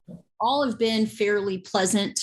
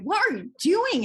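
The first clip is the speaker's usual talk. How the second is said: baffled; 10 semitones higher than usual. Retold or imitated. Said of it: "my friend is like".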